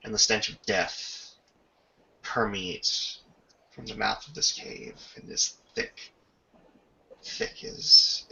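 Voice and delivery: normal talking voice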